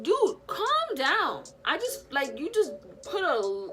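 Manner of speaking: slow, high, loud "calm down"; high, drawn-out fed-up plea; exasperated. Retold or imitated. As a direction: stretch it out, fed up and overwhelmed. Overwhelmed, snapping at the doctor.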